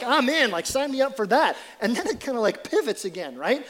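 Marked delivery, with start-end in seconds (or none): 0.0-1.6 eager mock sign-up, higher and louder. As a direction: play the overeager volunteer. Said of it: "You're like".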